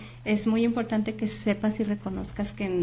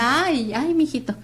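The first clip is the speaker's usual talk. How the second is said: cooing, fond and louder; mimando al bebé; 4 semitones higher, 6 dB louder, slower than usual. Retold or imitated. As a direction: dote on the baby like a doting mom. Mamá consintiendo al bebé. (voz de mamá que consiente)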